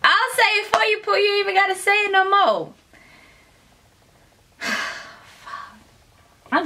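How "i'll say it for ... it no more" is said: high, fast teasing; excited teasing. Real, not acted. Teasing the husband, high and fast excitement. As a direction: tease him with a high rush.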